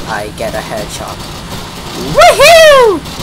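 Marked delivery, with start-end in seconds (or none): 2.1-3.2 shrieking jackpot winner. Real, not acted. Celebrating a kill in game.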